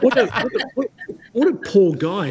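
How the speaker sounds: high, laughing "what a poor guy"; mock sympathy, half laughing; laughing mock pity. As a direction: pity him through giggles. Mock pity for long name.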